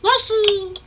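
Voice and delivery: high Mario wahoo cheer; wahoo yell. Pretend. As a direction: shriek it like Mario. Get Nintendo on your asses.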